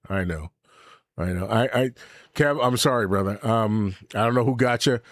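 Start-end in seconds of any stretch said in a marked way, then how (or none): none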